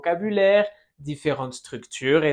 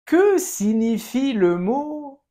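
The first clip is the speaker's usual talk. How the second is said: slow, high, a bit louder teacher model; slow model phrase; 6 semitones higher, 3 dB louder, slower than usual. Shown as a demonstration. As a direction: lay it out slowly for the class. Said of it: "Répète". (frase modelo lenta y aguda)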